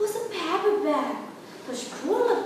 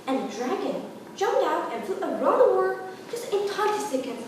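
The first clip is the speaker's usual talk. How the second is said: ordinary delivery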